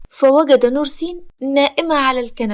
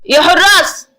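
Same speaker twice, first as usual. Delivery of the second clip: loud, raised shout; angry call; 4 semitones higher, 7 dB louder than usual. A narrator's reading. Amer summoning his guards, angry. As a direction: yell angrily for the men. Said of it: "عامر بغضب".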